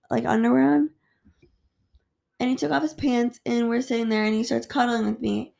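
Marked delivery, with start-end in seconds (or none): none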